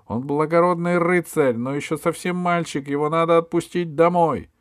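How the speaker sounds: высокий взволнованный голос Алисы; заступись за друга, упрашивая; заступничество девочки. A narrator's reading. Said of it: "вмешалась в спор Алиса". (высоко и горячо заступаясь)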